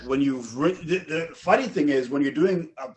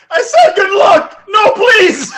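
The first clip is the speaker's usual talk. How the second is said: loud, high shouted begging; mock dragged-away plea; 14 dB louder, slower than usual. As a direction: wail as you're hauled off. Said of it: "as they're dragging him away".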